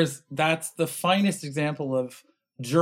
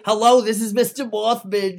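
mock Mothman voice; 4 semitones higher, 3 dB louder than usual.

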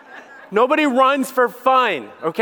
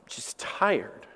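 weary, fading out; 9 semitones lower, 11 dB quieter than usual. Real, not acted. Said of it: "one word that I normally say now".

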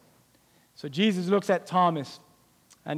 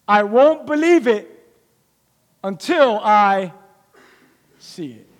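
loud, then slowing to a drop on see it; loud stubborn doubt; 6 dB louder, slower than usual. Retold or imitated. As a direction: insist you won't believe until you see. Thomas doubting, voiced by preacher.

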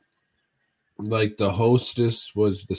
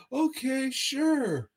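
waitress reply; 4 dB quieter, slower than usual. Retold or imitated. Waitress answers the order.

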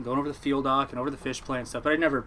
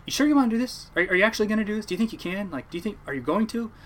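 higher-pitched nagging check-in; pestering doubt; 7 semitones higher than usual. Retold or imitated. Uncle checks on his nephew daily, pestering. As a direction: imitate an uncle asking over and over.

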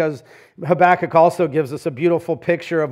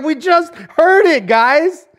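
much higher, loud, pleading cry; exasperated young believer; 11 semitones higher, 7 dB louder than usual. Retold or imitated. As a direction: plead with the crowd to wake up. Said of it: "And he's like".

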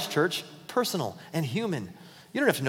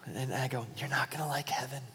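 quiet, unvoiced aside; whispered punchline; 4 semitones lower, 12 dB quieter than usual. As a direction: lean in and whisper the joke.

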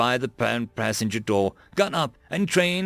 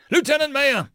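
high, raised shout; calling out; 13 semitones higher, 4 dB louder than usual. A narrator's reading. Colonel calls the platoon leader again, shouting. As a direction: yell for a lieutenant with authority. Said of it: "Michaels called his name again".

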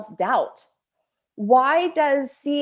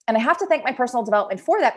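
usual speaking voice

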